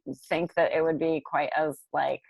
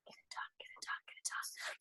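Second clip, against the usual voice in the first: whisper; 26 dB quieter than usual. Pretend. Chanting to herself about finishing tasks.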